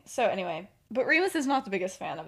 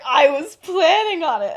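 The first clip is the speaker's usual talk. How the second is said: loud, raised, mock-haughty retort; 7 semitones higher, 11 dB louder than usual. Sirius retorting in the staged fight, acted haughtiness. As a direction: play it grandly disdainful and loud. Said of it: "his voice when he says that is, like, so haughty".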